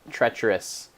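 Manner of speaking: usual speaking voice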